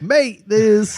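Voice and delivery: put-on high, loud jokey British accent; mock British slang. Quoting the group chat in a loud mock British voice. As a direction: toss out a hammed-up British "mate". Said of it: "Our group chats are like".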